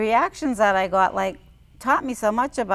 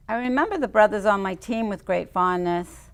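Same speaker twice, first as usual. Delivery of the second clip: ordinary delivery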